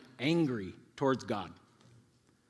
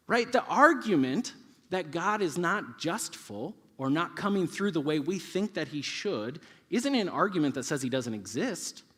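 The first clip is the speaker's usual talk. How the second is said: ordinary talk, nothing marked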